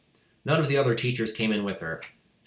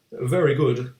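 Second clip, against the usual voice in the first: ordinary delivery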